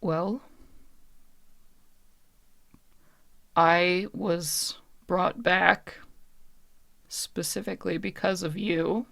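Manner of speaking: soft, halting confession with long pauses; lightly voiced spirit picking her words with care; guarded puppet woman, hesitant. Puppet woman explains why she was brought back, soft and halting.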